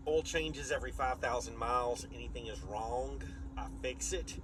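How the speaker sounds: ordinary talk, nothing marked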